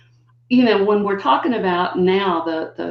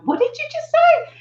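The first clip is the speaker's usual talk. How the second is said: high, startled question; squeaky what-did-you-just-say; mock surprised. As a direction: pop way up in disbelief. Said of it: "I'd go".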